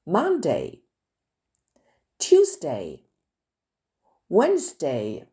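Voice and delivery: Monday, Tuesday, Wednesday in careful English; English pronunciation. Shown as a demonstration. Teacher modelling English day names for French pupils, careful English. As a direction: model each day name slowly in English. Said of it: "En anglais".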